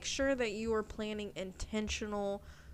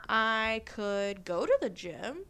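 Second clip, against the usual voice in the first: slow, louder, rising as a possibility; open-minded reframe; 4 dB louder, slower than usual. Acted. Host reframing a gym "should" as a choice, open-minded. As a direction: weigh it as one option, drawn out. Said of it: "what if I reframe that".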